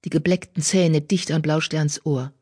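usual speaking voice

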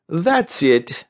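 high, oily encouragement; unctuous. A narrator's reading. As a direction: soothe with syrupy condescension. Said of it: "he said unctuously".

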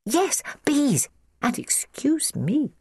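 high, half-whispered little voice; high, well-mannered small girl; polite child. A narrator's reading. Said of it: "said Christiana politely".

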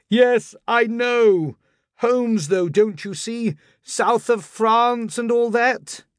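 high, drawn-out placating call; calling through door. Acted. Lodger to landlady through shut door, high placating call. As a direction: call back, high and slow, to fob someone off.

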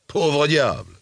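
high-pitched scornful exclamation; infinite disdain. A narrator's reading. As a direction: toss it off with utter disdain. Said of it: "avec un infini dédain". (exclamation dédaigneuse, voix aiguë)

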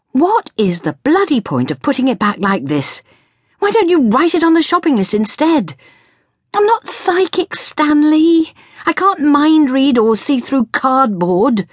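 high, rising rant of a fed-up mother; shrill-pitched exasperation, building; exasperated scolding. A narrator's reading. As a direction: scold a child at the end of your patience.

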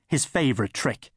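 plain reading voice

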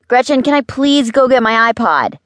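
put-on high, loud kid nagging; insistent high-pitched request; pestering plea. A narrator's reading. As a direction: pester in a high child's voice, asking yet again. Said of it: "asked for the three millionth time".

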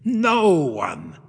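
put-on slow, seething old man's vow; shaking with rage. A narrator's reading. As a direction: spit it out through rage. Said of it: "shaking with rage".

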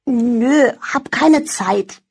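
slow, half-asleep mumble; sleepy mumble. Acted. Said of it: "murmelte sie verschlafen".

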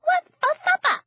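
granny voicing her false teeth, squeaky. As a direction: make the dentures talk in a squeak. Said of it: "in the squeaky voice she always used for the teeth".

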